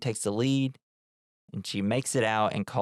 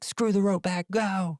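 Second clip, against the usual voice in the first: urgent yell; 10 semitones higher than usual. Retold or imitated. Beth urges Sarah to flee collapse.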